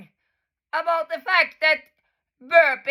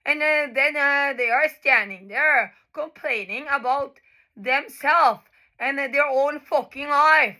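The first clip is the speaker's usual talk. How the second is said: ordinary talk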